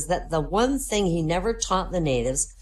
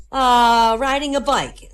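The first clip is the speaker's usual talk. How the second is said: higher and louder, caught off guard; flustered padre; 8 semitones higher, 8 dB louder, slower than usual. Acted. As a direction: fumble for a cover story. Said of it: "the padre's really flustered".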